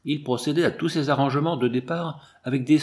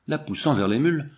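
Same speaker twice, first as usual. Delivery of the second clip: ordinary delivery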